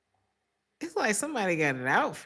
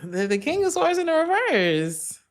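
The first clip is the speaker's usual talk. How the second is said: excited card reveal; 11 semitones higher, 8 dB louder than usual. Real, not acted.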